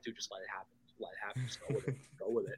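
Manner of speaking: very soft, low near-whisper; hushed reassurance. Retold or imitated. Cool Rick to his panicking brother, hushed and low. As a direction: quietly talk a friend down, imitating him.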